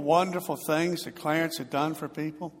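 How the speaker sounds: ordinary delivery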